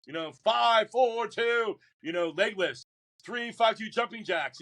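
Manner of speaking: mock drill instructor yelling a jumbled count. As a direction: bark the count like a drill instructor who can't count. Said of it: "but they can't count".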